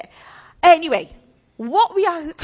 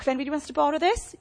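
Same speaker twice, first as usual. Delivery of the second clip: ordinary delivery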